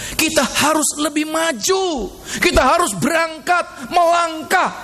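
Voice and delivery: high, ringing preacher's call; rousing exhortation. Real, not acted. Preacher urging the congregation to step forward, rousing exhortation.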